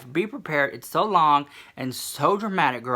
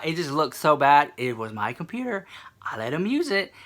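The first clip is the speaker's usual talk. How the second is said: normal talking voice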